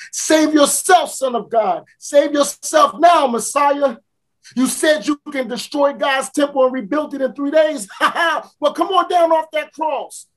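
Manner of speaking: mock the dying man, daring him; cruel mocking taunt. Taunting at the cross, laughing.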